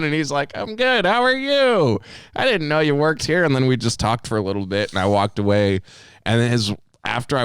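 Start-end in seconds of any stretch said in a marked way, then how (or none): 0.6-3.4 high-pitched, chirpy imitation; friendly greeting. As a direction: greet an acquaintance in exaggerated high voice. Customer's acted friendly greeting.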